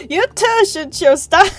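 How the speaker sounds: high, loud flustered protest. Real, not acted. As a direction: whine at them to stop. Flustered at donor.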